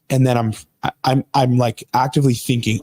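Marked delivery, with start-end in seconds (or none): none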